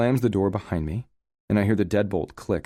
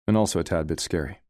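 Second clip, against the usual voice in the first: ordinary delivery, nothing marked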